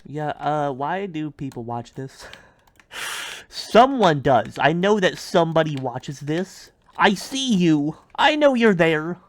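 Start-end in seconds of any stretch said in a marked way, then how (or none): 3.5-9.2 loud, insistent mock accusation; emphatic, high-pitched insistence; calling out viewers. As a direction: call them out, voice raised and climbing.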